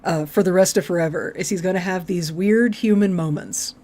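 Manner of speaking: normal talking voice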